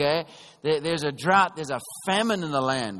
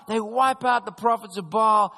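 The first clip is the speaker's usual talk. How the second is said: ordinary talk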